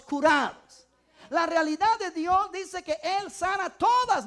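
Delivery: slow, loud, ringing proclamation; full-force preaching. Acted. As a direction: preach it out to the congregation.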